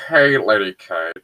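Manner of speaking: lightly nasal smug neckbeard; slow smug neckbeard greeting. Acted. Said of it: "can I do the neckbeard voice".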